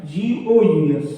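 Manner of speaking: slow letter by letter spelling. Shown as a demonstration. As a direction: spell out the ending slowly. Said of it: "ये शब्द आ गए".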